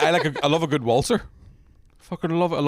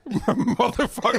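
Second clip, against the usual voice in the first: slow, drawn-out curse through laughter, higher; laughing mock curse; 9 semitones higher, much slower than usual. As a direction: stretch the swear out while cracking up. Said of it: "I was like".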